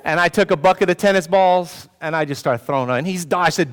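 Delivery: ordinary delivery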